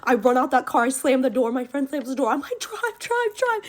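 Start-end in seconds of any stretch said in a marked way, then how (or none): 2.6-3.6 high-pitched panicked plea, retold; panicked urging. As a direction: urge the driver to flee, acting it out. Panicked yelling to driver in story.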